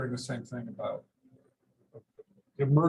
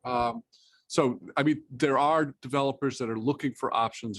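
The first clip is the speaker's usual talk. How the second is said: louder, higher voice after being asked; speaking up; 5 semitones higher, 6 dB louder than usual. Real, not acted. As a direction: raise the voice so listeners can hear. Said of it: "can you speak up a bit more".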